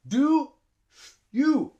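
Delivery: "do", a pause for breath, "you"; full, high "do" and "you" as separate words; two separate breaths. Shown as a demonstration. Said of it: "breathe two times".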